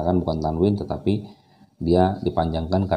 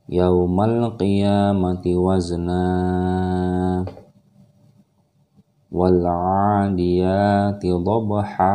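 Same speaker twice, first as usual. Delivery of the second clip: slow, louder melodic recitation; Quran recitation; 4 dB louder, much slower than usual. Shown as a demonstration. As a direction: chant it slowly, clearly. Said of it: "Kita akan praktekkan lagi".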